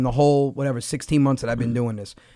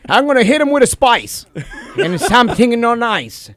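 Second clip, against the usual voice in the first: hammed-up Italian mobster voice, pitched up, laughing through it; mock Italian tough guy; 8 semitones higher, 4 dB louder than usual. Comedian doing a cartoon Italian goon, hammed up. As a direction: play a cartoon Italian goon threatening with salami.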